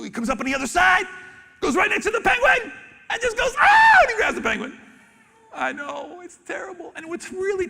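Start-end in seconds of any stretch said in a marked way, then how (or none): 3.6-4.0 mock attack yell, much higher and louder; hammed-up predator pounce cry; sudden shout. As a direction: bark it out like a lunging predator. Seal lunges at penguin.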